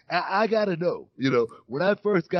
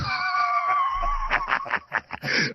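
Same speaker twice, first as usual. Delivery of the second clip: long, high-pitched cackling laugh; real laugh. Real, not acted. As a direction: crack up at your own punchline. Cracks up after own punchline.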